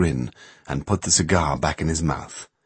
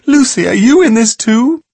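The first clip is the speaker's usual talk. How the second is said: desperate call; 9 dB louder than usual. A narrator's reading. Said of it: "she called out desperately".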